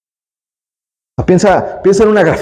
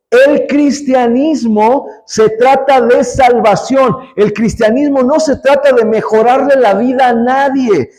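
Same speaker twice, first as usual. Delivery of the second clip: raised, insistent pulpit voice; full-force preaching; 4 semitones higher than usual. Acted. Preacher proclaiming to the congregation, fervent preaching. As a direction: drive the point home to the church.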